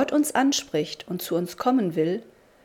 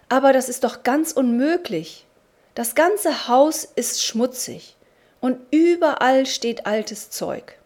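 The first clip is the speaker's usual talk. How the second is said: alarmed protest; 5 semitones higher, 5 dB louder than usual. A narrator's reading. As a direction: plead that it cannot be. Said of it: "rief er erschrocken".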